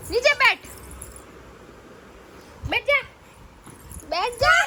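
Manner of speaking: high, loud order to sit down; stern shout. Real, not acted. Mother shouting at her child to sit down, stern loud order.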